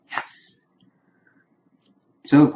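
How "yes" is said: breathy whispered cheer of delight; hushed gleeful whisper; whispered yes. Real, not acted. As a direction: whisper your satisfaction under your breath.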